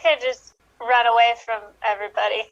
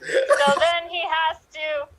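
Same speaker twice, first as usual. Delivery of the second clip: high, dramatic trailer narration, slowing as it goes; movie trailer voice; 4 semitones higher than usual. Acted. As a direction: pitch it like a hammed-up film trailer. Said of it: "in your best, uh, trailer voice".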